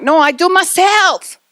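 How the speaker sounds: put-on high, drawn-out kid's no; stubborn toddler. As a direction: refuse help like a three-year-old. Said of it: "and you're like".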